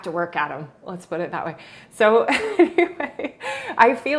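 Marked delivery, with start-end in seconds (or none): 1.9-4.2 laughing. Real, not acted. Laughing off a joke.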